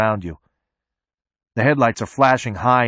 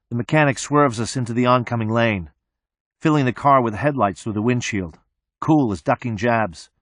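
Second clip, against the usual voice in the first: usual speaking voice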